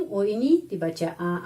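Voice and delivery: ordinary delivery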